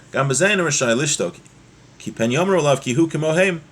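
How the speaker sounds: normal talking voice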